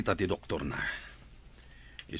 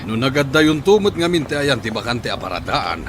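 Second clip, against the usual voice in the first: stern loud scolding; 5 semitones higher, 10 dB louder than usual. Acted. Father scolds in the street.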